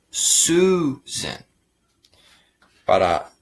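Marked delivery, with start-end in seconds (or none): 0.1-1.4 Susan pitched high, with a long "oo"; U sound in Susan. Shown as a demonstration. Teacher shows learners why it fits, Susan with a high, long "oo". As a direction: stretch the first vowel of Susan as "oo". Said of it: "Porque la sonido es U".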